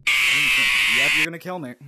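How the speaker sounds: loud resigned panic; panicked yell. Real, not acted. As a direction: yell it as he closes in. Monster approaching.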